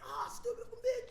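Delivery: high-pitched gamer outburst; mock a nerd losing a game; raging gamer. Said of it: "you just lost Fortnite".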